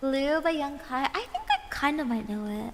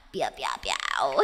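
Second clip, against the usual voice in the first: cat meow. Pretend. Playful cat sounds on stream.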